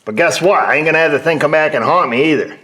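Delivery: loud and high, defiant; loud defiant retort; defiant outburst. Real, not acted. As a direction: push back hard and loud.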